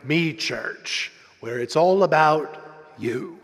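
deep promo voice with a pause before the last word; announcer tagline. Retold or imitated. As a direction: intone the slogan like an announcer, dropping low at the end. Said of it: "summed it all up with the tagline".

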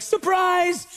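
party shout. Retold or imitated. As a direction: yell it as the lights come on. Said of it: "they're like".

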